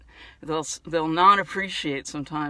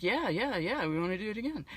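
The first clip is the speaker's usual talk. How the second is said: lightly voiced eager puppet, soft; soft, bouncing pitch on each yeah; Dave; 3 semitones higher, 6 dB quieter, faster than usual. Dave agrees to appear again, softly playful eager voice. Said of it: "Dave's like".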